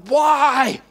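long drawn-out "Why"; wailed protest. Retold or imitated. Protesting God's "not good for you", wailed protest.

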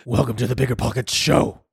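low-effort-free, strained superhero growl; hammed-up masked vigilante voice; Batman. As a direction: growl it as a mock show host. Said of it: "in the Batman voice".

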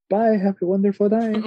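high, sing-song customer service farewell; overly cheery clerk. Retold or imitated. The guy at the store saying bye, high and cheery. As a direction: chirp it like the too-cheerful cashier. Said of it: "that guy that goes".

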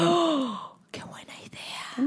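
gasp, then a hushed slow whisper; breathless delighted whisper; excited whisper. Real, not acted. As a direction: whisper it like a thrilling secret. Reacting to invention idea.

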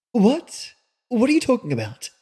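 high, quick, startled question; unsure, half afraid to ask; hesitant. Acted. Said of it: "she asked hesitantly".

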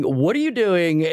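toned-down imitation of a screaming boss; retold screaming. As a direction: imitate an irate boss yelling, held back.

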